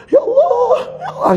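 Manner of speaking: wailing "Ya Allah" very high, between a laugh and a sob; preacher acts out forcing himself to weep; forced crying. Shown as a demonstration. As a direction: squeeze out a mock sob, half laughing. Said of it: "gitu kan, paksa, paksa, paksa".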